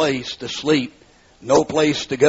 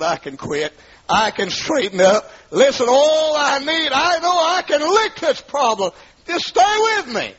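desperate plea; 12 semitones higher than usual. Retold or imitated. Husband begging wife not to leave, desperate high plea. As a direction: beg her to stay, voice climbing.

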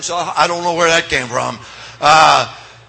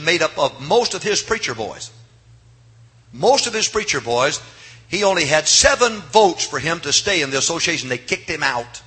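usual speaking voice

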